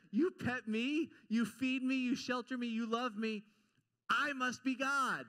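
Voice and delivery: a cat that knows it's the boss; smug cat. Retold or imitated. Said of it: "A cat says".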